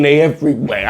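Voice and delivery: usual speaking voice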